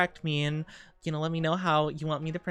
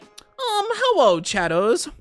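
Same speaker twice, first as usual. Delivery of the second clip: Discord mod voice; 3 semitones higher, 6 dB louder than usual. Pretend. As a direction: put on a cringey Discord mod voice. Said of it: "one of those awful, like, Discord mod voices".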